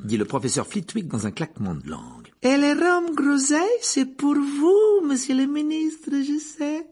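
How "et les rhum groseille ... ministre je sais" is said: high, slow, bright-voiced barmaid. A narrator's reading. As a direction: lift the voice high and serve the drinks sweetly. Narrator voices barmaid Rosmerta.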